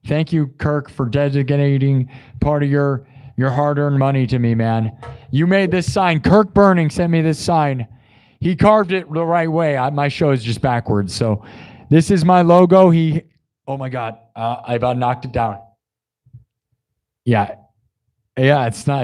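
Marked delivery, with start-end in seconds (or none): none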